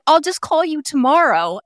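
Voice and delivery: high, breezy brush-off; breezy husband. Retold or imitated. Husband at the bar casually puts off his stranded wife, high and breezy. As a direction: toss it off like it's no big deal. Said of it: "And he's like".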